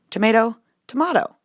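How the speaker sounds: "Tomato, tomato": first tuh-MAY-toh, then tuh-MAH-toh; two tomatoes. Shown as a demonstration.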